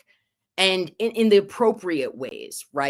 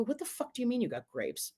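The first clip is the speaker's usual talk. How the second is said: hushed, fast incredulous mutter; half under her breath, baffled and quick; incredulous retort; 5 semitones higher, 12 dB quieter, faster than usual. Retold or imitated. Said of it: "I go".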